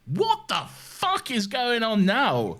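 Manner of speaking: high, drawn-out comic disbelief; mock bewilderment. Viewer retelling his reaction to constant reveals, comically bewildered.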